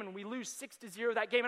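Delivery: ordinary talk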